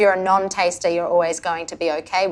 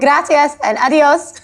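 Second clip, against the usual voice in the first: slow, drawn-out playful sign-off in Spanish; playful Spanish goodbye; 6 semitones higher, 5 dB louder, slower than usual. Real, not acted. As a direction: say goodbye with a cheeky grin. Playful sign-off ending interview.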